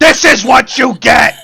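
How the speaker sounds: loud high teasing yell; gleeful shout. Real, not acted. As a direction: shout it while tickling. Tickling back, loud.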